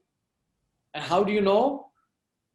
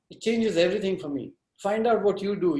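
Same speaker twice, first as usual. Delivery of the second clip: usual speaking voice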